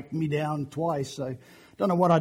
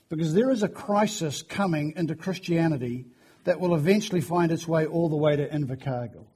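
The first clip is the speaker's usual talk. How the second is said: ordinary talk, nothing marked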